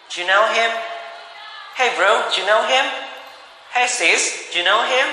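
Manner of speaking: high, upbeat "Hey bro, do you know him?"; lively casual question. Shown as a demonstration. Teacher voicing a casual chat with a friend, upbeat. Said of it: "harus pakai expression ya".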